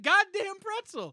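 high, slow, laughing repeat; laughing protest. Retold or imitated. Repeating his harmless words, laughing.

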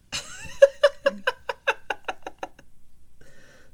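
breathless squealing laugh; high wheezing laugh. Real, not acted. Cracks up after Yoda bit.

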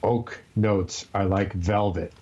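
slow and deep. Shown as a demonstration. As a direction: savor each word slowly. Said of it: "Let's say it together".

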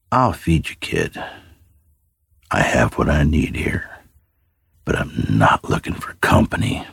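a lightly voiced wary hermit, dropping to a hushed breath; gruff old man. Old man answers the boy.

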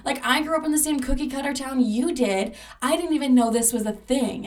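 a friend's mock outrage at the news; exasperated friend. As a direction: play the friend, baffled and annoyed. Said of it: "She's like".

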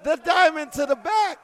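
high, loud play-by-play roar; excited shout. Real, not acted. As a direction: scream the big hit. Weapon strike live call.

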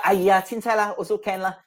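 casual Singlish shrug, lightly voiced; easygoing Singlish, no fuss; Singaporean accent. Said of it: "You know".

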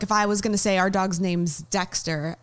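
ordinary talk, nothing marked

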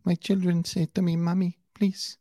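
high, mocking Spanish accent; pleading mock-Spanish mother; [name]'s fake Spanish accent. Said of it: "But God, that accent".

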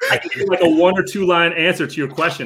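high, laughing false starts; facetious, laughing. Real, not acted. Guest teasing his hosts with a short answer, facetious. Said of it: "I'm being a little facetious".

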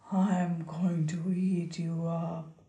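low, menacing troll whisper. Acted. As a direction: hiss it like a lurking troll. Troll threatens goat.

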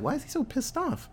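high, soft mock-puzzled voice; imitating puzzled viewers. Puzzled fans asking about Vader's anger, imitated in a high quiet voice. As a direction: ask it small and high-pitched, baffled.

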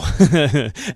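a sudden loud burst of real laughter. Real, not acted. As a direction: laugh out loud at your own slip-up. Laughs at own slip-up.

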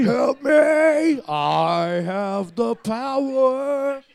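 high wizard shout; booming wizard voice. Acted. Sex wizard bit.

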